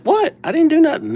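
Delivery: high-pitched, defensive mock protest; feigned innocence. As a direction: squeak out your innocence like a caught pupil. Retold feigned innocence to teacher.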